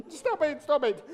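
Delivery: plain reading voice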